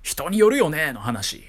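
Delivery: ordinary delivery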